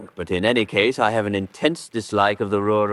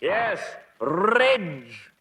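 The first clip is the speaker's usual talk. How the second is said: higher, impatient calling down; an exasperated host shouting down at a dim policeman; 11 semitones higher than usual. Acted. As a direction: snap it down the slope, fed up.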